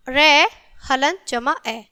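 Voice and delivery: slow, syllable by syllable; slow spelling out. Shown as a demonstration. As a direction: spell it out slowly for children. Teacher spells out letter by letter. (धीरे-धीरे अक्षर जोड़कर बोलना)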